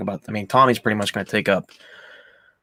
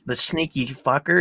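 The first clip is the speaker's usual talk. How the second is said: normal talking voice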